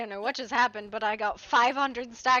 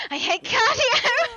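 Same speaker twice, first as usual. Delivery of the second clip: laughing; 11 semitones higher, 6 dB louder, slower than usual. Real, not acted. Laughing while chasing in game.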